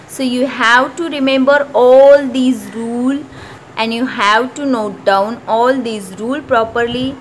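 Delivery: slow, deliberate textbook reading; reading the rule. Acted. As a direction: dictate the rule slowly for students to copy. Slow instruction to students. (धीमी आवाज़ में नियम पढ़ना)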